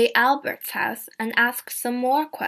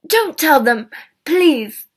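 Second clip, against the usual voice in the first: pleading; 5 semitones higher, 10 dB louder, slower than usual. Acted. Roger begs, face red.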